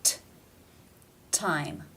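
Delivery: crisp true T at the start of "time"; real T sound. Shown as a demonstration. Teacher showing learners the real T in "time", true T. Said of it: "pronounced with the real T sound".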